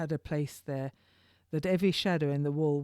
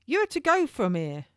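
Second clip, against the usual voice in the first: higher, commanding jailer voice; turnkey's voice; 6 semitones higher, 4 dB louder than usual. Acted. Turnkey rousing the prisoner from bed, higher commanding voice. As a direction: bark orders like a prison turnkey.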